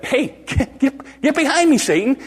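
high, stammered informal command; colloquial rebuke. Retold or imitated. Jesus to Peter in everyday words, high-pitched rebuke. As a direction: blurt it high, tripping over the start.

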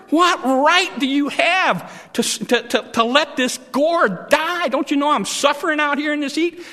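high, loud whining rant; angry rant. Acted. Preacher as Jonah ranting at God, high and loud. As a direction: complain to God at full pitch.

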